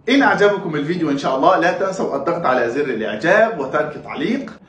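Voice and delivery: ordinary talk, nothing marked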